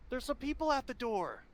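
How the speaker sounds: put-on high-pitched child calling back; small boy's voice. Boy telling his mother about visitors, high child's voice.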